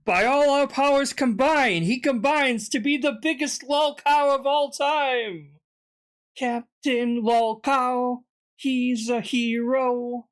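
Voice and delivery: mock superhero proclamation, drawn out; lightly hammed-up Captain Planet call; cartoon hero announcer. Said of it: "Captain Planet".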